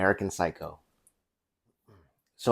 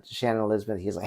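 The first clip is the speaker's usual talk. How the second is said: ordinary delivery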